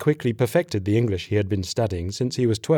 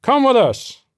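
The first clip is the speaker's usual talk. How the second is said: high, loud military command; shouted order; 14 semitones higher, 6 dB louder than usual. A narrator's reading. Captain to his corporal, shouting an order. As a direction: order him along at full voice. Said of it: "his captain ordered".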